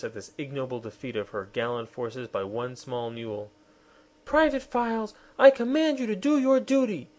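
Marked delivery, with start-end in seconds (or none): 4.2-7.1 high, raised insistent command; queen crying orders at her private. Acted. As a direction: call him to his duty. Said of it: "she cried again".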